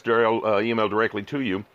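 ordinary talk

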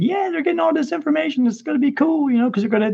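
high, eager inner voice; eager excitement. Retold or imitated. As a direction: sound thrilled at the prospect. His excited inner thoughts.

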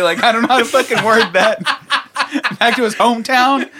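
high, loud, real laughter breaking in; helplessly amused, talking through laughs; laughing. Real, not acted. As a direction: crack up while fumbling for words. Cracking up over wrong word choice.

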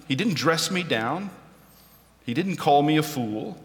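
ordinary talk, nothing marked

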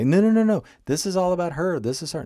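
high, loud burst of protest, easing off; arguing back. Acted. Acted listener arguing back.

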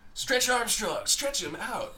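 high, loud ad-announcer pitch; toy commercial announcer. Retold or imitated. Co-host recalls Saturday morning cartoon commercials, announcer voice. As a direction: sell it like a cartoon-break toy ad. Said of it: "that announcer voice is almost as iconic".

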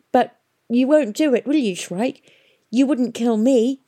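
anxious pleading. A narrator's reading. Girl pleads with the stalker for her life, anxious. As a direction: plead upward, half disbelieving.

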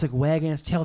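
normal talking voice

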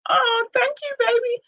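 very high, sweet thank-you to a loved one; tender aside. Real, not acted. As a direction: coo it gently. Sweet aside to someone nearby.

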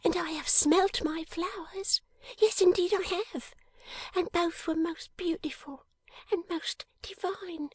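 high, hushed and dreamy; high breathy girl's whisper; tender whispering girl. Acted. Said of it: "cried the little creature".

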